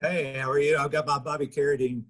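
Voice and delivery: ordinary talk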